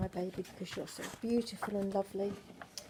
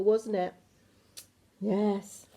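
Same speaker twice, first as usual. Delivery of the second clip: usual speaking voice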